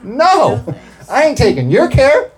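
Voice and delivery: high and loud playful refusal; a loud high hammed-up no; mock refusal. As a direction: shout it off as a joke. Refusing "take care" in jest.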